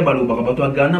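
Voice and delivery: ordinary talk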